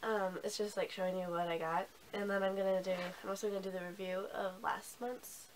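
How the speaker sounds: usual speaking voice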